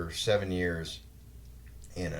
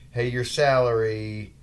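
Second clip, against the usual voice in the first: mock company announcement; 4 semitones higher, 8 dB louder, slower than usual. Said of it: "the company basically said".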